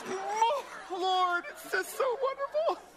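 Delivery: very high, loud mock-ecstatic worshippers; swooning parody of congregation praise; overjoyed churchgoers. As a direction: wail in exaggerated spiritual rapture. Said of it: "people are in the back of the church going".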